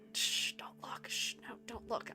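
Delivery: hushed whisper. Pretend. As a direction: whisper it secretly. Hiding milestone from chat.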